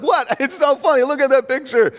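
hammed-up amused disbelief; amused protest. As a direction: protest like it's hilarious and they must look. Acted amused protest to friend.

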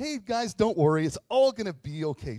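loud, hammed-up pep talk; mock reassurance. Imagined Jesus cheering up lepers, hollow reassurance. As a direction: gush empty comfort at full volume.

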